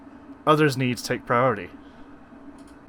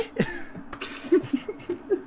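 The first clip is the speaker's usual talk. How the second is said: giggle; 3 dB quieter than usual. Acted.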